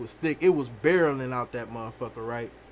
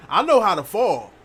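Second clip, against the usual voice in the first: louder and higher boast on know; emphatic boast; 5 semitones higher, 10 dB louder than usual. Real, not acted. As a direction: shout the brag, hit know. Boasting about falling skills.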